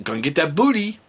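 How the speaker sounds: light high teasing; playful teasing. Pretend. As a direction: tease her lightly. Teasing game again.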